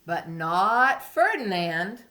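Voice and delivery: storybook narration, softening at the end; reading to a toddler. A narrator's reading. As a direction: read it gently for little ones. Reading story to young children.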